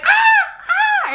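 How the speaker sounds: very high shrieking imitation of panicked people; mock crowd scream.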